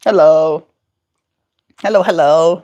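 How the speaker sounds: high, bright-eyed coaxing voice for a bird; high sing-song coaxing of a pet; pet baby talk. Acted. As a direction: coo at the parrot to come over. Greeting the bird.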